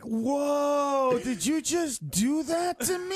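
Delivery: high, slow, stretched-out shock; mock-appalled questioning; offended disbelief. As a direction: draw it out, wounded and incredulous. Acted offended reaction to partner.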